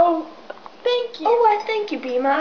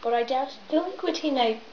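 cracking voice mid-phrase; 3 semitones lower, 7 dB quieter than usual. Acted. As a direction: voice cracks on a line. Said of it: "my voice just cracked".

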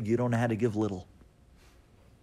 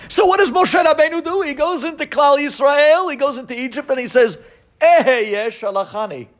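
fervent preaching; 12 dB louder than usual. Acted. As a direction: proclaim it as a sermon peak. Building preaching climax.

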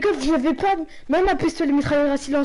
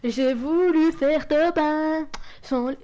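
slow, stretched mock boast; drawn-out slow; much slower than usual. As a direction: drag every word out slowly. Repeats the slow mock line.

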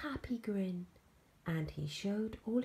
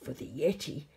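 loud, low monster voice; big creature, drawing out the last words; monster Hetty; 3 semitones lower, 6 dB louder than usual. Acted. Monster offers George a goat, loud low creature voice.

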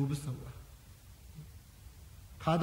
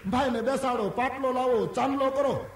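hammed-up jubilation; 8 semitones higher, 5 dB louder than usual. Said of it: "એટલું બોલે બે જણા".

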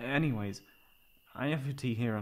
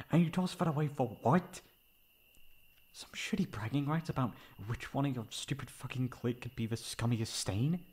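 rising fury; 3 semitones higher than usual. Acted. Friend berates the ex over the phone, furious. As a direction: tear into the ex without stopping. Telling off the ex.

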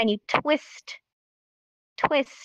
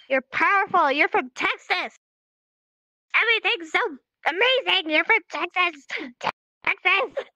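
higher, proud, showy delivery; emotional Texas; 7 semitones higher than usual. Shown as a demonstration. Teacher showing students to put emotion into the Texas sign, proud. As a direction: sell it with big Texan pride. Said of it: "Gotta put your emotion into it".

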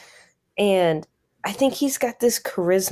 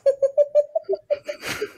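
high laugh. Real, not acted. Laughs at own joke.

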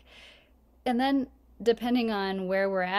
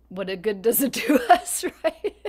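louder, pitch climbing into real laughter; laughing; 3 semitones higher, 9 dB louder than usual. Real, not acted. Joking aside to the meditation group, laughing. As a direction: break up laughing through the words.

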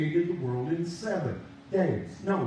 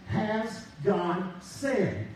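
slow, drawn-out doubting question; the preacher voicing Satan's tempting question to man, slowly; Satan's sly question; much slower than usual. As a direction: stretch each word to sow doubt. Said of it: "he still uses against man today is".

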